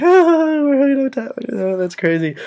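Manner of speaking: high squealy mock voice of the friends; mock squeal. Friends mocking the hookup in a high squeal. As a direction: squeal it like excited girls.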